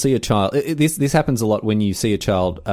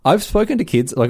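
ordinary delivery

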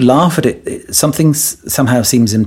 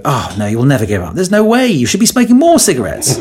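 teasing; 2 semitones higher than usual. Acted. Therapist provoking a smoker who wants to quit, teasing. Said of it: "By teasing you".

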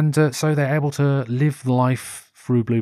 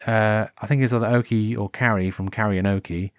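ordinary talk, nothing marked

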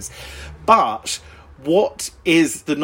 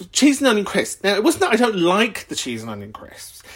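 usual speaking voice

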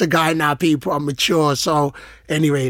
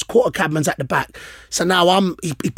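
ordinary talk

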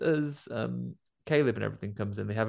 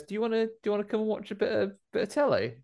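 put-on very high, fast eager boy voice; mock little boy; 11 semitones higher, faster than usual. Homer inviting the sister to watch TV, eager high boy. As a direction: rattle it off like an excited kid. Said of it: "he goes".

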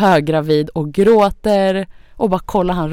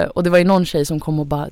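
ordinary talk

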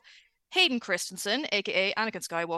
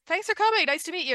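lightly imitated celebrity farewell, higher pitched; a quick, high-pitched polite goodbye; lightly voiced meet-and-greet send-off; polite celebrity sign-off; 9 semitones higher, faster than usual. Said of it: "and he goes".

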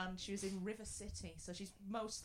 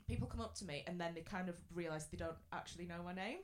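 usual speaking voice

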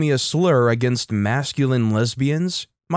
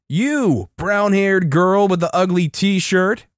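high, drawn-out mock hillbilly holler; hammed-up hillbilly call, stretched words; hillbilly mating call; 6 semitones higher, 3 dB louder, slower than usual. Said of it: "in a hillbilly mating call".

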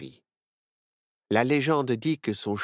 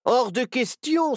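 high, drawn-out loud refusal; crying out; 5 dB louder than usual. A narrator's reading. Three friends refuse the captain together, crying out. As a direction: exclaim defiantly for three friends. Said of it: "s'écrièrent en chœur les trois amis".

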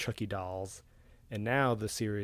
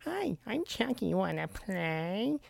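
put-on high, slow creepy kid voice; chirpy toy doll greeting; weird kid's voice; 10 semitones higher, slower than usual. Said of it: "a really weird kid's voice the whole time".

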